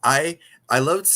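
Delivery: normal talking voice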